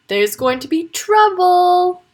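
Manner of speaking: slow, high, relishing tone; gloating. Acted. Piglet gloating about mummy's punishment, smug. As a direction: savour the coming trouble. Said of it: "gloated Freddie".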